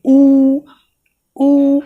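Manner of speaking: ऊ said long with lips rounded; a slow, drawn-out ऊ from the lips. Shown as a demonstration. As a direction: push the lips forward on ऊ. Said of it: "जब बोलेंगे ना ऊ".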